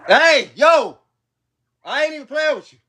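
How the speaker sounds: loud, high yell across the yard; fed-up yell at barking dogs. Real, not acted. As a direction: shout at the dogs to stop. Yelling at dogs off camera.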